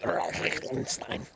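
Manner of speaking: garbled, drawn-out growly mangling; slow mock garble of a name; groggly name. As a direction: imitate an impossibly complex, guttural name. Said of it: "sounds super complex and groggly".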